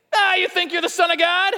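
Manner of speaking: high, loud strained taunt; jeering robber. Acted. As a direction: hurl a jeer from the cross. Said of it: "The people are mocking you".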